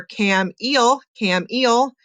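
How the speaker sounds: "cam, eel" with a long EE in the second syllable; long E mispronunciation. Shown as a demonstration. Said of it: "Doesn't make sense".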